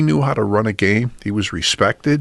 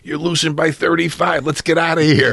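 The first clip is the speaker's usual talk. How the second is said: high-pitched mock holler back; imitated father's yell; 8 semitones higher than usual. Referee dad answering the losing coach, mock yell. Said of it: "my father yells back".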